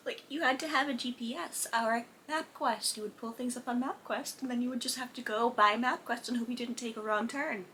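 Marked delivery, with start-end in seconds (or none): none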